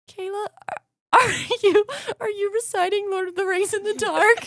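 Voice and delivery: high, exaggerated teasing brother voice; mocking brother. Imitating her brother catching her reciting at night, high teasing voice. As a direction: squeak it out like a stunned, amused big brother.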